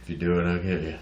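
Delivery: flat deadpan cat voice; David Puddy voice. Pretend. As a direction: voice the cat like a deadpan tough guy. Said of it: "Sounds like David Puddy".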